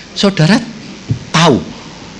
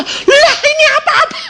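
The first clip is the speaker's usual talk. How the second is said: put-on very high squeaky panicked kid; a boy waking to blood; shocked child. Son voiced, shocked, laughing. (anak kaget bersuara sangat tinggi sambil tertawa)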